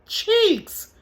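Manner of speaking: very high-pitched startled echo; mom's startled reaction. Retold or imitated. Mother echoing her son's news on the phone, startled. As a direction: squeal it back in mock disbelief. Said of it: "now here go me".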